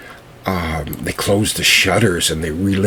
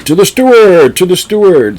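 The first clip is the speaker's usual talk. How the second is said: very high, loud boozy toast; rowdy toast; 12 semitones higher, 9 dB louder than usual. A narrator's reading. Robbers toast the steward who let them in, loudly. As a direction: raise your glass and bellow it. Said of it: "raised a glass of ale and said".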